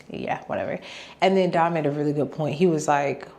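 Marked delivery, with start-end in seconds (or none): 0.1-0.8 whispered whatever. Real, not acted. Muttered dismissal.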